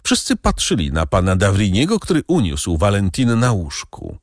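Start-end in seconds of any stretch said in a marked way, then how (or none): none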